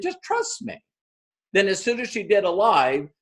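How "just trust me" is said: high and very soft; almost breathe it, reassuring; gentle reassurance. Retold or imitated. Coach softly reassures Tara before her first live. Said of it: "and I said".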